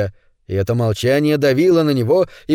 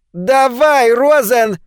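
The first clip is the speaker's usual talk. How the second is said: high, louder shrill order; shrill command; 10 semitones higher, 5 dB louder, much slower than usual. A narrator's reading. Charlie ordering Ned to explain, shrill and commanding. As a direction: bark at Rosen like a petty boss. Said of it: "скомандовал ... своим визгливым голосом".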